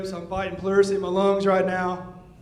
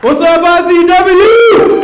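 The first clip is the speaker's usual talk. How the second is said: slow, drawn-out high shout to the crowd; crowd greeting shout; 10 semitones higher, 13 dB louder, slower than usual. Real, not acted. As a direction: call out to the whole arena, stretching every word. Returning wrestler greets the crowd.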